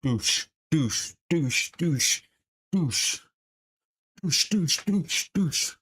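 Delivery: punch sound effects. Shown as a demonstration. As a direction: mimic punches with quick mouth sounds. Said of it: "Or you can go like this".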